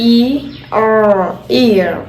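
very slow, spell ഇ ആർ then blend ഇയർ; drawn-out blending demo; slow letter blending. Shown as a demonstration. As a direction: sound out the letters slowly for kids. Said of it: "ഇയർ അല്ലേ?".

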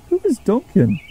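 high-pitched, mildly puzzled question. A narrator's reading. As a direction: ask in light bewilderment. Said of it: "asked Rebecca, puzzled".

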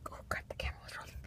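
whispered oops, caught out; whispering. Real, not acted. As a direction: whisper it, embarrassed. Realizes camera was recording.

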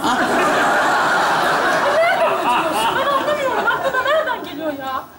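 laughing scold. Real, not acted. Wife mock-scolds, laughing.

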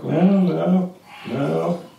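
lightly voiced matter-of-fact daughter. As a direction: imitate a daughter plainly turning Mom down. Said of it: "Just goes through very matter-of-factly".

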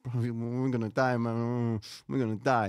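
imitated panicky mumble, words run together; mumbling under breath. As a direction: mumble it like a doomed passenger muttering to himself. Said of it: "he kept mumbling under his breath".

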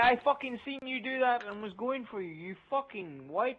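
high, loud furious outburst; angry rant. Real, not acted. Raging at the opponent who killed him, high loud angry outburst. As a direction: tear into him.

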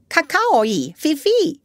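high, slow bird call; high-pitched, drawn-out bird words; bird language. A narrator's reading. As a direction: speak it like a parrot in bird talk. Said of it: "in bird language".

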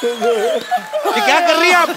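exasperated. Real, not acted. Exasperated repeat, slow and high.

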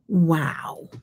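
ordinary talk, nothing marked

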